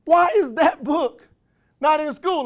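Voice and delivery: wondering aloud. Retold or imitated. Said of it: "I used to wonder".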